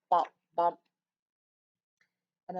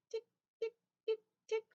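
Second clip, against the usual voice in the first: high, softer clock ticks; imitating the kitchen clock, high ticks; ticking clock; 4 dB quieter, faster than usual. Shown as a demonstration. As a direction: tick it out high like a little clock. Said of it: "the clock in my kitchen go".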